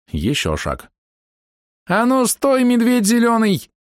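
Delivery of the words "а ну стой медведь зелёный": high, slow female yell; drawn-out high holler; yelled warning. A narrator's reading. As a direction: cry out to halt someone, high-pitched. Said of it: "завопила трёхствольная берёза".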